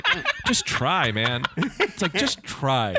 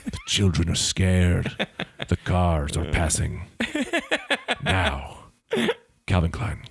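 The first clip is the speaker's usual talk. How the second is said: low, slow dramatic ad read; deep radio voice; 7 semitones lower than usual. Acted. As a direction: read it like a movie trailer. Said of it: "You do have a radio voice".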